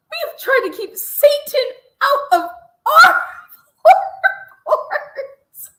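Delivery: loud, high yelling through laughter; screaming laugh. Real, not acted. Host screaming with laughter at the guest. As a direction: shriek it out laughing.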